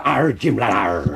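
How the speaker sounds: growled pirate voice with long arrs; Long John Silver. Acted. Quiz host gives a clue as a growling pirate. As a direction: roll out a hammy pirate growl. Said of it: "the character Long John Silver".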